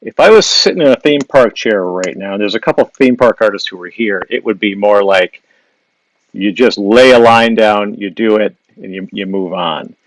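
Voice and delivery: ordinary talk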